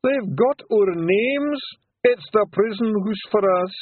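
slow, high, wailing despair; mock sobbing. A narrator's reading. Daft Woolly despairing, slow high wail. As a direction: lament being caught.

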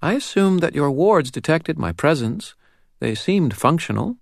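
put-on high, composed little girl's voice; steady, assured child. A narrator's reading. Girl explaining the wards to Dresden, calm and assured. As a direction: sound perfectly certain of yourself. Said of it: "her voice steady and assured".

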